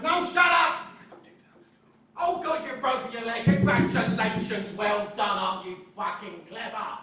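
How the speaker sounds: yelling high and loud, sarcastic congratulations; snapped rant. Retold or imitated. Man at the bar snaps at a friend with a broken leg, furious sarcastic yell. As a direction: blow up at the fussing, mocking the injured friend. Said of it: "I just snapped".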